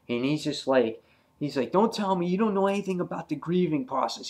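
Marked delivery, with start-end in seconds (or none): 1.7-4.3 fast, high-pitched retold retort; pissed off. Grieving Alan rejects Andrea's advice, pissed off. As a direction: rattle off his angry objection, pitched high. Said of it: "all pissed off".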